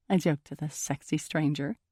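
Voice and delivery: usual speaking voice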